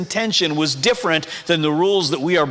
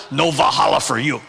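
lightly put-on bad Spanish accent; a hint of mock Spanish accent, lower; Odin, bad Spanish accent; 4 semitones lower than usual. Preacher voices a god refusing Valhalla, bad Spanish accent. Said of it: "Odin speaks in a bad Spanish accent".

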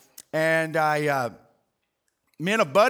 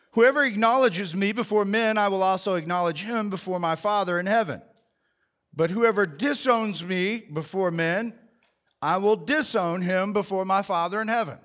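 measured, solemn Bible reading; scripture reading; 2 semitones higher, 4 dB louder than usual. Acted. Pastor reading Jesus's words to the congregation, solemn. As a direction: read the verses with weight.

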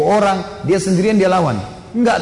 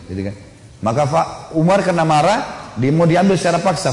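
normal talking voice

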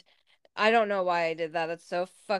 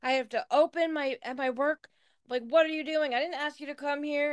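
higher pitched incredulous retold scolding; retold outrage; 7 semitones higher than usual. Roommate scolding the intruder in her room, pitched-up outraged quote. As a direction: demand what he's doing, voice pitched up in outrage. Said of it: "and I was like".

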